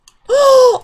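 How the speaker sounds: very loud, high gasp; delighted gasp. Real, not acted. Player sees the new cat, gasping in delight. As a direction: gasp sharply at something adorable.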